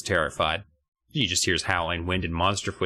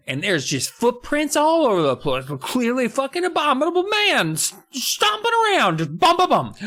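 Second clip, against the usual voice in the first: building to a very high squawk; hysterical ranting; 3 dB louder than usual. Acted. Host relays Dad's discovery of monster footprints, mock-hysterical rant.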